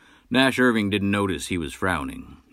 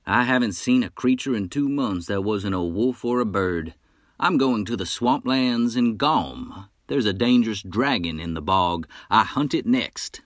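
a higher, louder cocky wolf hunter's voice; boastful hunter; 4 semitones higher, 4 dB louder than usual. Acted. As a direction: brag like a swaggering frontier hunter. Nash Irving, boastful hunter.

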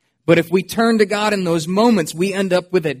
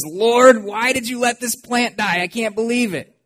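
whiny Jonah; 6 semitones higher than usual. Acted. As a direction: whine like a sulking prophet. Jonah complaining about the plant.

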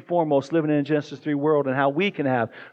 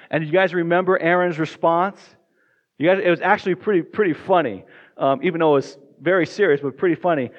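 normal talking voice

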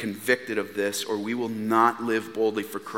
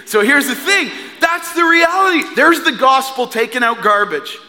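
triumphant; 12 semitones higher, 8 dB louder than usual. Real, not acted. Preacher crowning his garbage-day story with joy. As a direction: voice leaps up, loud and ringing.

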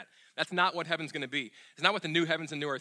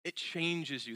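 ordinary talk, nothing marked

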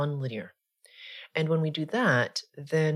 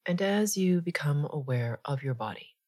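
guided meditation; 3 dB quieter than usual. Acted. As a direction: ease them gently into stillness, unhurried.

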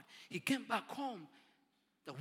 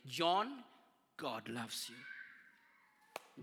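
tender; 5 semitones lower, much slower than usual. Retold or imitated. Old man's gentle phone message.

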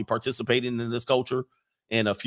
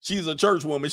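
ordinary talk, nothing marked

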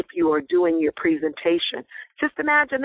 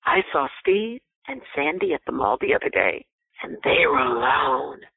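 insinuating gossip; 5 semitones lower than usual. Shown as a demonstration. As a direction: let the last words hang with a knowing hint. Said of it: "But if I said to you".

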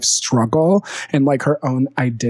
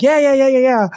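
excited; 10 semitones higher, 5 dB louder than usual. Retold or imitated. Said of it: "and was like".

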